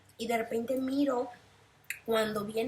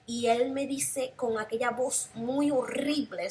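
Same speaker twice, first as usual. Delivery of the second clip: usual speaking voice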